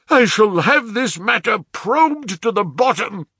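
very high, loud vow; half mad with rage. A narrator's reading. Father vows to probe the theft, half-mad shout. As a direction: shout it in anguished fury. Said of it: "half mad with grief and rage".

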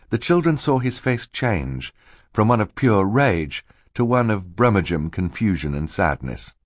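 plain reading voice

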